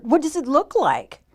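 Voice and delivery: irritated storybook retort; snappish. A narrator's reading. Grumpy Bird answers nosy Raccoon, snappish voice. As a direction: fire the question back as grumpy Bird. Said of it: "snapped Bird".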